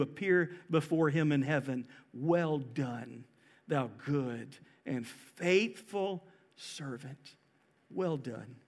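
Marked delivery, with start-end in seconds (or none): 2.1-8.6 low, soft, slow praise; tender commendation. Acted. Jesus to believers arriving in heaven, tenderly. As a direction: say it gently, with solemn approval.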